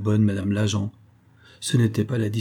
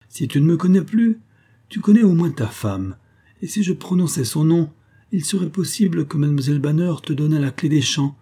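menacing crook; 6 semitones higher than usual. A narrator's reading. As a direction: lean on him with a smug threat. The crook threatens the pastor. (escroc menaçant, plus aigu et plus fort)

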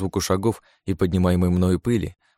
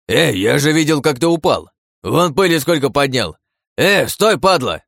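loud high furious yell; angry bandit yell; 6 semitones higher, 6 dB louder than usual. A narrator's reading. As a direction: scream at him from the cave. Bandit yelling at hero.

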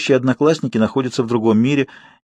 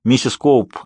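normal talking voice

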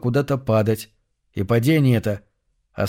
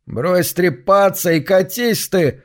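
grumbling; 6 semitones higher, slower than usual. Acted. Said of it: "буркнул сам себе Лен".

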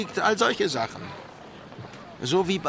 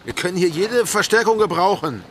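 louder, gleeful bragging threat; boisterous threat; 3 semitones higher, 5 dB louder than usual. Acted. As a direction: bellow it like a rowdy pirate. Boisterous threat about her fighter. (lautes prahlerisches Drohen)

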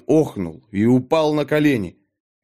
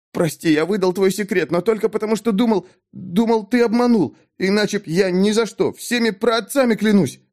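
high, frantic begging for forgiveness; terrified pleading; 8 semitones higher than usual. A narrator's reading. Mik on his knees begging the sorcerer, terrified. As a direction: plead in a much higher voice, throat tight with horror. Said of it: "Горло сжималось от ужаса, однако он шептал".

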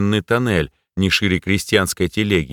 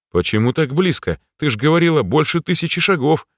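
surprised; 7 semitones higher than usual. A narrator's reading. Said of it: "удивился я".

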